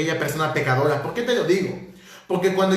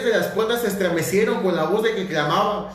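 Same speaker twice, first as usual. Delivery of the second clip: higher, lifted reading of scripture; 4 semitones higher than usual. Acted. Preacher reading the seraphim verse to the church, higher and lifted. Reads Isaiah aloud in preaching.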